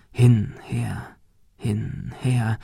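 soft, hypnotic whispered rhythm; faded whisper like a lullaby; rhythmic whisper. A narrator's reading. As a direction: whisper it, rocking slowly. Rocking rhythmically in the dark.

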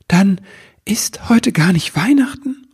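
high-pitched, hesitant disbelief; astonished question. A narrator's reading. Elf learns it is not Christmas, astonished. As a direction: climb high in tentative surprise. Elf realises he mistook the date.

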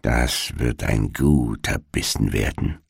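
low, soft witch mutter; muttered to herself. A narrator's reading. Witch over the sleeping children, low and muttering. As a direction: murmur greedily under your breath. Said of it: "so murmelte sie vor sich hin".

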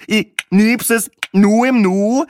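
slow, high hammed-up line with "Nu" rising; mocking rising take. Speckhut drags out his joke to the table, rising at the end. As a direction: drag it out, lift the end on "Nu".

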